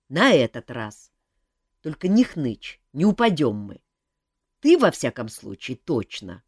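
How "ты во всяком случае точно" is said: ordinary delivery, nothing marked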